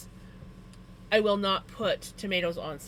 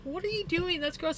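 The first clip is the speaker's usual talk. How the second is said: grossed out; 10 semitones higher than usual. Retold or imitated. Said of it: "And I'm like".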